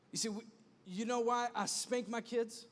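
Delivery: normal talking voice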